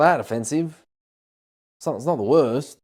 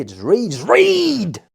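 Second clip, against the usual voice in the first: exasperated; 4 semitones higher, 4 dB louder, much slower than usual. Real, not acted. Exasperated plea.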